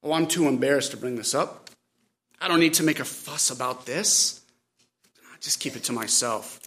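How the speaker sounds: low, subdued voice of embarrassed pride. Acted. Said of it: "Pride says".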